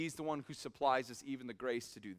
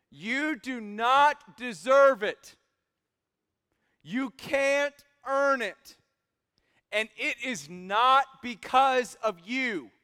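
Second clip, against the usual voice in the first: impassioned preaching; 7 semitones higher, 8 dB louder, slower than usual. Acted. Preaching climax, slow and loud.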